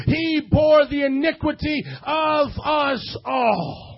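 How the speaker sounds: loud, slow, fervent sermon delivery; full-force preaching. Acted. Preacher driving home the crucifixion to the church, full force. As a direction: preach it to the back row.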